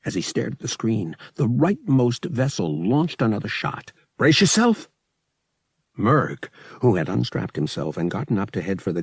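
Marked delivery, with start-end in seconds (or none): 4.2-4.9 high, loud warning; urgent shout. Acted. Shot incoming.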